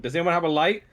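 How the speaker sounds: loud call to the group; shouting. Acted. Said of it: "shouts to the rest of the group".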